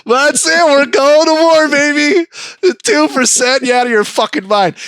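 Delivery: high, loud war cry; rally for war, high and loud; rallying forefathers. Retold or imitated. Forefathers declaring war over tea tax, high and loud. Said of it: "We're like".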